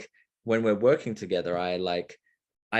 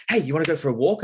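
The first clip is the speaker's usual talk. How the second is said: louder, higher eager invitation; eager dog-owner invite; 6 semitones higher, 6 dB louder, faster than usual. Retold or imitated. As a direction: pitch it like you're asking a dog to walk. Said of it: "more of a dog energy of, like".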